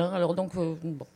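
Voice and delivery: ordinary delivery, nothing marked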